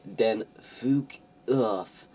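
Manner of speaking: high, slow whiny rant; sneering parody of a teen author telling readers off; mock angry fangirl. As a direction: ham up the writer's furious note. Reading the author's note in a put-on voice.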